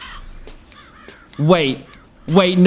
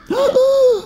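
startled gasp. Real, not acted. Player fumbles a move in the game, startled gasp. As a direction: gasp sharply in alarm.

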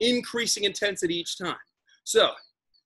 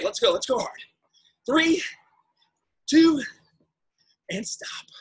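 aerobics instructor; 4 semitones higher than usual. Acted. Pushes the class through intervals.

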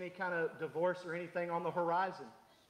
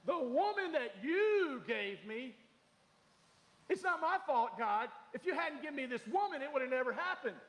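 Adam passing the blame; 8 semitones higher than usual. Retold or imitated. Preacher voicing Adam blaming Eve before God, mock pleading. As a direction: whine the excuse at God, a touch mocking.

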